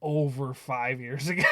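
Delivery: ordinary talk, nothing marked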